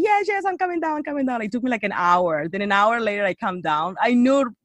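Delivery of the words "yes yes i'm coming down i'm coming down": high, hurried-sounding mock protest; harried reply. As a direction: fend off the nagging in a raised pitch. Acts out answering the pestering friends.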